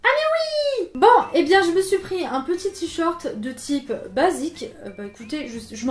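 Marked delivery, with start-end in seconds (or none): 0.0-0.9 high-pitched ah of remembering; sudden realisation. Real, not acted. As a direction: pop up high as it comes back to you. Suddenly remembers the purple shirt.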